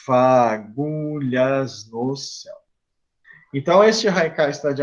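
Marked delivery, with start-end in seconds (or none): 0.0-2.6 slow, drawn-out syllables; counting syllables. Shown as a demonstration. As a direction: measure out the verse one syllable at a time. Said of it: "cinco de novo". (separando as sílabas, lentamente)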